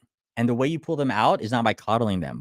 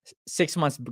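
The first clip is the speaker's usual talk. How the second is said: normal talking voice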